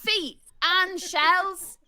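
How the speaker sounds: drawn-out, hammed-up Bristolian accent; exaggerated Bristolian. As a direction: improvise an advert in broad West Country. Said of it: "with that voice, please".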